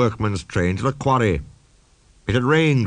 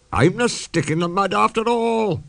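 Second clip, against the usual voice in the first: gurgling laughter; 10 semitones higher than usual. A narrator's reading. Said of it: "he gurgled helplessly".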